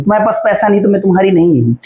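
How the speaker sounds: ordinary talk, nothing marked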